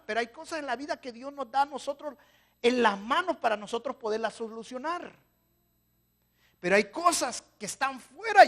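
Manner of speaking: loud, high fervent preaching; full-force preaching. Acted. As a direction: thunder it at the congregation. (predicación a todo pulmón, fervorosa)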